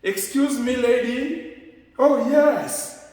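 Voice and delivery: high and loud, excuse me lady; polite attention call. Shown as a demonstration. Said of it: "sans les noms".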